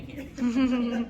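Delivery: teasing the audience through a laugh. Real, not acted. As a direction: laugh as you tease them.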